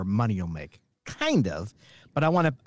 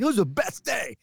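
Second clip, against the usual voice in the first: put-on higher, eager kid voice; excited kid; 8 semitones higher than usual. The kid telling his dad about the day, a touch eager. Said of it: "he's so excited".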